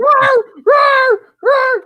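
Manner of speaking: high shouted roar, mocking barking dogs. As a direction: yell "roar" like a barking dog. Imitating the dogs.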